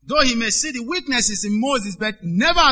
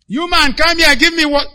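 loud, high demanding order; bossy shout; 7 semitones higher, 8 dB louder than usual. Retold or imitated. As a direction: bark it like a puffed-up boss. Proud man ordering people around.

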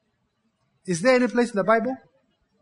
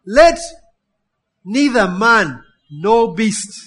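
royal proclamation; 6 dB louder, much slower than usual. Acted. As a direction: call it out like a herald. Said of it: "proclaims the decree".

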